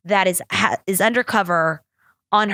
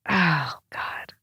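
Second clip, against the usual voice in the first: a hushed, breathless aside to herself; quick whispered exasperation; whispered oh God; 5 semitones lower, 5 dB quieter than usual. Real, not acted. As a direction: drop to a whisper under your breath.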